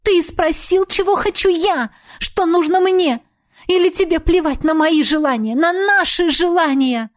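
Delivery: high, pleading reproach; anguished outburst. Acted. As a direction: throw it at him in pain.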